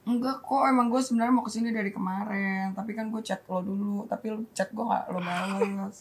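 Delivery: hushed retold apology; subdued explanation. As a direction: imitate a soft, apologetic friend. Re-enacts friend's subdued apology.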